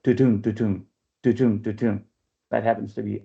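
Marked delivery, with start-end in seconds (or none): 0.0-2.0 rhythmic tu-tum, tu-tum with a lower voice; iambic train rhythm. Shown as a demonstration. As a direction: chant tu-tum with the second beat stressed. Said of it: "they hear the iambic dimeter".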